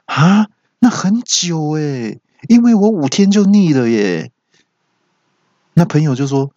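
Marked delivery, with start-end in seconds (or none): 0.1-4.3 high-pitched exclamation of surprise; astonished. Acted. Voice assistant reacting to a five-year relationship, high astonished exclamation. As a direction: react amazed, voice jumping up high. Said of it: "语音就很惊讶的说".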